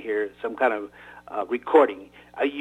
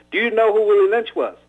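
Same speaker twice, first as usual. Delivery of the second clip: higher, louder irritated demand; 8 semitones higher, 5 dB louder than usual. Acted. Old man demanding an answer at gunpoint, irritated. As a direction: press the question hard, gun under his chin. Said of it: "Irritated, Solomon put the gun".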